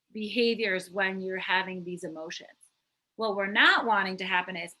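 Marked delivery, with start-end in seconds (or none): none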